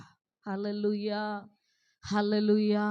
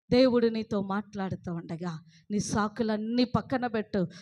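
normal talking voice

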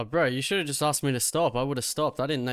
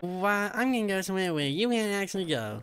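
higher lisping nerd echo; mocking accent; 5 semitones higher than usual. Parroting the officer who is leaving, mocking nerd accent. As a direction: parrot it back in a high nerd voice. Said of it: "doing the accent too".

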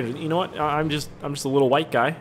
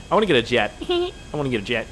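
normal talking voice

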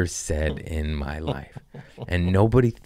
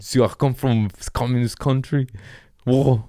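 higher-pitched, badly faked foreign accent; bad [name] accent; 6 semitones higher than usual. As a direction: do a celebrity's accent and get it wrong. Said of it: "I'm just bad at accents".

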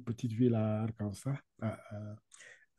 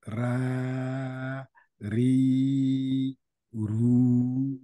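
ra ri ru, getting louder on each syllable; model syllables for repeating; 4 dB louder, much slower than usual. Shown as a demonstration. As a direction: call out ra, ri, ru for the class to copy. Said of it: "Ok, on y va". (ra, ri, ru détachés, de plus en plus forts)

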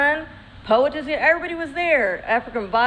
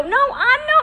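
laughing reply; 12 semitones higher, 4 dB louder, slower than usual. Retold or imitated. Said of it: "And I go".

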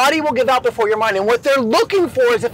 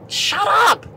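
high, exasperated retold outburst; mock irritated yell; 10 semitones higher than usual. Trainee fed up with the moaning man, mock irritated yell. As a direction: snap it as a retold complaint.

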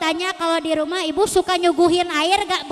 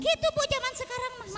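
rushed wife voice; 5 semitones higher, 10 dB quieter, much faster than usual. Retold or imitated. Wife voice, fast.

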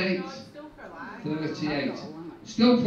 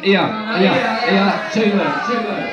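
loud, quick calls over the room; calling out; 8 dB louder than usual. Real, not acted. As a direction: call across the hall.

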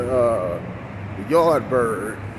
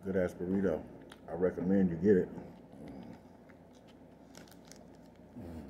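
talking while chewing; eating between words; mouth full; 5 semitones lower, 12 dB quieter than usual. Real, not acted. Seen 0: burrito held at mouth, bites and chews between words throughout.

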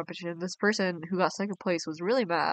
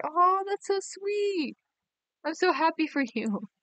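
much higher, slow gushing aww; touched aww; 9 semitones higher than usual. Real, not acted. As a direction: melt over the sweet gift. Touched by a viewer's gift.

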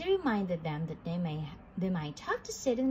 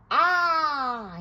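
loud, raised "aah" roar; giant roar; 5 semitones higher, 8 dB louder than usual. A narrator's reading. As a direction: roar a huge open-mouthed "aah". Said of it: "he roared".

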